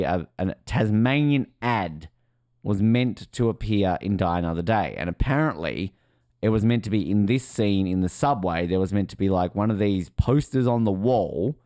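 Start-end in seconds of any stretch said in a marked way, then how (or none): none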